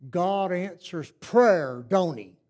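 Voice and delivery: put-on very slow, drawn-out old man voice; toothless old man. Toothless driver grins back at the boy, very slow. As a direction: let each word hang with a grin. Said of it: "and he said".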